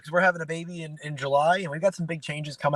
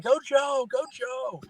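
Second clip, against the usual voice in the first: mock child's scream; 9 semitones higher, much slower than usual. Dad imitating his daughter cheering at the TV, mock scream. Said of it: "Nora was screaming".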